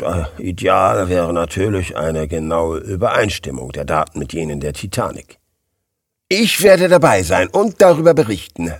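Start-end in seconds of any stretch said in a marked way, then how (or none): 6.3-8.7 louder, higher, eager announcement; begeistertes Beben. A narrator's reading. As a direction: declare it with mounting enthusiasm. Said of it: "mit einem begeisterten Beben in seiner Stimme". (mit begeistertem Beben in der Stimme)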